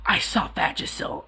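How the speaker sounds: whispered "I saw Vagisil"; embarrassed whisper. Shown as a demonstration. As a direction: hush it like a secret. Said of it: "I can't just say".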